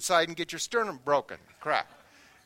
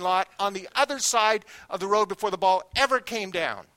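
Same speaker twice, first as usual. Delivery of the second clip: louder, building storyteller climax; preacher hitting the climax, much louder; rising excitement; 3 semitones higher, 7 dB louder than usual. Real, not acted. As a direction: belt out the big home run. Climax of the home run story.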